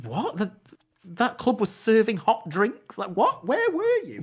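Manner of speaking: rising pitch, slowed and astonished; incredulous. Retold or imitated. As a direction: people can't believe the club served hot drinks. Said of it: "and people were like".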